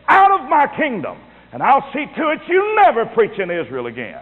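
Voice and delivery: high-pitched indignant banishment; hammed-up indignant decree; mock royal outrage. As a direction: play an offended king throwing someone out. Imagined king banishing the preacher.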